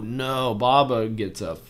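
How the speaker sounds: normal talking voice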